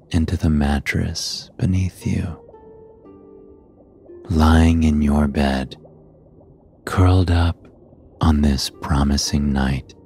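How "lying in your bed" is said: usual speaking voice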